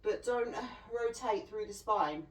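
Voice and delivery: usual speaking voice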